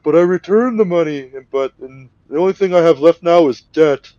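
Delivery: high, loud whiny excuses; pleading kidnapper. Retold or imitated. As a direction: plead your case like a cornered man. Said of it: "And he's just like".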